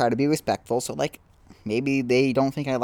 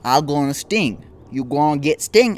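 mock grumpy wasp, louder and broken English; angry wasp; 4 semitones higher, 8 dB louder than usual. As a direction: play a cranky wasp threatening you. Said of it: "they see you, they're like".

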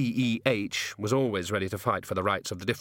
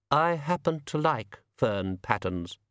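slow, slightly raised, touch of chill; mildly cold, drawn-out retort; coldly; 4 semitones higher, slower than usual. A narrator's reading. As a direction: state it coldly, taking your time.

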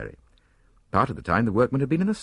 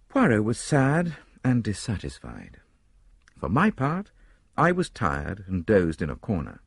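ordinary delivery, nothing marked

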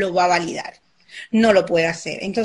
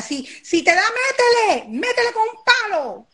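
much higher urging voice; pushy parent; 10 semitones higher than usual. Retold or imitated. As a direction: egg the child on to hit back. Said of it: "Qué estamos creando ahí".

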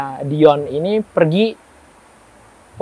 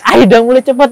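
much louder, higher shout to get moving; shouted hurry; 8 semitones higher, 11 dB louder than usual. Retold or imitated. Retelling urging Dion at the graveyard, loud shout. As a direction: bark at him to hurry up. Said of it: "Aku ngomong kayak gitu kan".

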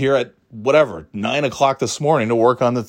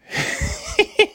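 mocking laugh. Dad mocking him.

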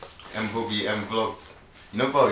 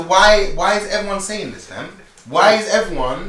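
exasperated; 8 semitones higher, 6 dB louder, slower than usual. Real, not acted. Exasperated repeated question.

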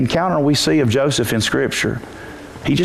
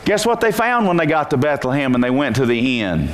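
ordinary delivery

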